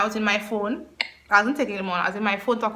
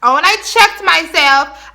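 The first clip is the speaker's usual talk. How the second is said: ordinary talk, nothing marked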